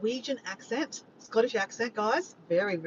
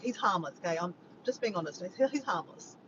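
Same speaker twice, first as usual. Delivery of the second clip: normal talking voice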